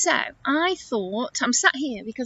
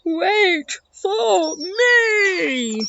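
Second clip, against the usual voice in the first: higher, very drawn-out call; shouted call; 8 semitones higher, much slower than usual. Acted. Elephant left behind in jungle, long drawn-out call. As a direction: stretch each word, calling after friends. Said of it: "he shouted".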